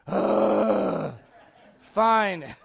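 long huff, then a grudging "fine"; exasperated sigh. Acted. The Lord giving in to Moses, exasperated. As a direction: give in with a heavy groan.

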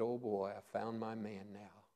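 God quietly pleased with Moses; soft pleased aside. Acted. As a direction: murmur it with quiet delight.

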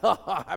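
short imitated laugh of twisted amusement; put-on laugh at another's misfortune; mock sadistic laugh. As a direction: act out a brief, cruel chuckle. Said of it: "thought it was funny and laughed".

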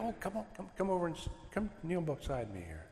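put-on soft, tender parent inviting a child to pray together; gentle invitation. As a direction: quietly welcome the child in beside you. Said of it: "and you go".

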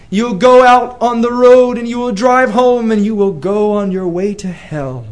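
ordinary talk

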